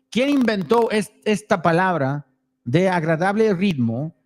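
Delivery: reading aloud in Spanish; reading foreign language. Real, not acted. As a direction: read the Spanish text aloud. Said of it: "I'm gonna try to read it in Spanish".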